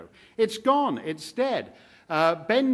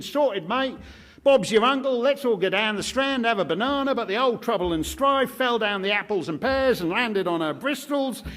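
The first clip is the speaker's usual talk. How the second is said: higher hammed-up Cockney accent; mock Cockney; 5 semitones higher, 4 dB louder than usual. Mocking EastEnders for the audience in a hammed-up higher Cockney accent. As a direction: put on a cheeky Cockney accent, lifted high.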